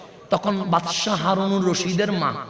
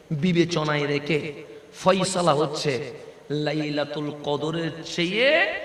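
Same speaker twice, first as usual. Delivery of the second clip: slow, louder, swelling to the final word; emphatic sermon verdict; 2 semitones lower, 6 dB louder than usual. Acted. Preacher proclaiming to the congregation, slow emphatic declaration. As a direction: lean in and declare it.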